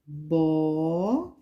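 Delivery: "bola" as long rising "bo", long pause, "la"; split into syllables. Shown as a demonstration. Teacher counting mouth openings for children, "bola" in two separate syllables. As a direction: stretch "bo", wait, then separate "la". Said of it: "você abre duas vezes a boca".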